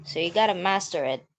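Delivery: normal talking voice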